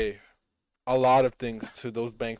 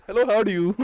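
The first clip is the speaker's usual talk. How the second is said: put-on higher-pitched Indian-accented greeting; 7 semitones higher, 4 dB louder than usual. Imagined call center worker greets a caller, Indian accent. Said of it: "you could do a pretty decent Indian voice".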